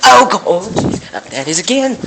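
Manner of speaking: high, loud startled yell; scared scream. Real, not acted. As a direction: shriek at the jump scare. Jump scare in game.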